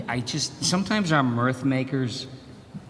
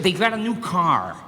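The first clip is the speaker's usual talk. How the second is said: ordinary talk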